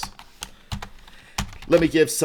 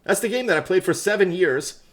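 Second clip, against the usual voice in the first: much higher put-on voice of a smug veteran; mock know-it-all gamer; 9 semitones higher than usual. Answers his own question as a smug veteran player. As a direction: play the smug veteran boasting. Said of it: "I just asked".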